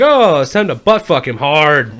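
loud, drawn-out mock proclamation; hammed-up slow holler; mock gleeful announcement. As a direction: announce it slowly and loudly, hamming it up. Acting out his gleeful hypothetical reaction.

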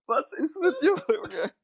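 high and breaking into laughter; laughing disbelief. Retold or imitated. Bouncer retelling the odd guest, laughing in disbelief. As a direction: ask it while laughing helplessly. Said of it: "ich denk mir".

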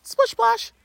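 high silly sing-song; playful high voice. Acted. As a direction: chirp it like a kid. Playful water sound words.

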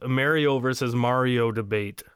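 Mario with "mare", then Mario with "mar"; two ways of saying Mario. Shown as a demonstration. Host naming the Mario debate, contrasting both pronunciations. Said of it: "How do you guys say Mario?".

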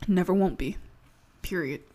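ordinary talk

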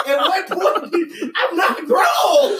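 loud, high, drawn-out comic complaint; hammed-up indignant outburst; exasperated protest. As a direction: shout it slowly through laughter. Protesting parents' demand for money.

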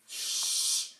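sharp hissed shh; hissed shush. A narrator's reading. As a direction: hush him with a quick hiss. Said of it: "hissed Professor McGonagall".

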